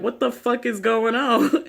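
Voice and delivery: high, slow mock bewilderment with a laugh; imitated shocked savior. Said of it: "the savior dude is like".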